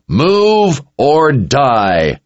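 high, slow, drawn-out yell; yelled threat. A narrator's reading. Richard picks up speed at the crowd, yelling a threat. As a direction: stretch each word into a yell. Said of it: "Richard yelled as he picked up speed".

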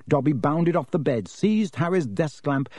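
ordinary delivery, nothing marked

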